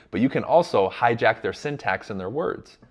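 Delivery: ordinary talk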